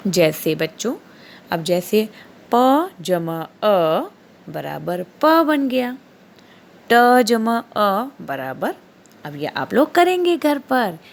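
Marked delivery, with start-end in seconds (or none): 2.5-8.7 slow dictation. Shown as a demonstration. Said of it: "teacher spelling out sums".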